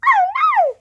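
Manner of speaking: very high, loud squeak; high cartoon mouse cry; squeaky mouse. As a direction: squeak like a worried little mouse. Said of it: "squeaked Mouse".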